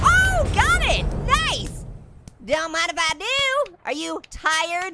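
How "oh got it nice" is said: high, loud triumphant cry; excited shout. Real, not acted. Catching the bouncing bomb.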